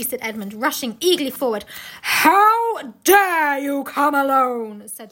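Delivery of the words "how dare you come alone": very slow, loud, imperious witch; menacing queen's outrage, hugely stretched; terrible voice. A narrator's reading. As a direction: thunder it, drawing out every word. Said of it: "in a terrible voice".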